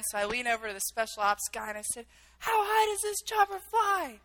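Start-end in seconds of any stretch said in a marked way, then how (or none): 2.4-4.2 much higher-pitched retold question; anxious question. Captive leaning over to special ops guy, high anxious question. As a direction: call it over engine noise, high and urgent. Nervous question shouted inside noisy helicopter.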